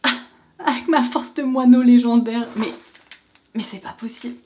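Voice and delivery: straining to open a lid; struggling with a stuck cap; opening a stuck jar. Real, not acted. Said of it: "j'arrive pas à l'ouvrir".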